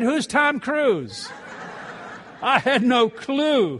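high, slow, laughing disbelief; slowed, high laughing question. Retold or imitated. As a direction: laugh through the question, amused at yourself. Retelling own clueless reply, laughing.

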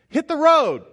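high, loud brush-off; mock shout. Disciples shouting the children away, high and loud. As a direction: shoo them off with a sharp, high yell. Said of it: "they're looking at kids going".

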